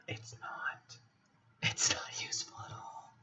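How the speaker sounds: soft unvoiced aside, deadpan; whisper. Real, not acted. Muttering back to the card at the table.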